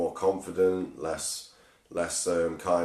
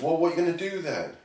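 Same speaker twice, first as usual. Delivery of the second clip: higher-pitched nagging question to oneself; raised, quizzing voice of inner fear; little inner voice; 8 semitones higher, faster than usual. Retold or imitated. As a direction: imitate a small doubting voice in the head. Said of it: "my little voice in my mind goes".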